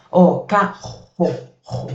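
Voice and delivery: "ro" said with a throaty guttural r, throat half closed; guttural r demo. Shown as a demonstration. Teacher showing students the guttural r sound. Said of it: "bem gutural".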